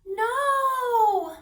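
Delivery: high, loud, drawn-out croaky no; creaky cry. Acted. Hidden creature in the box refuses, loud croaky cry. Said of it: "The voice creaked and croaked".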